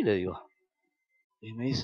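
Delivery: ordinary talk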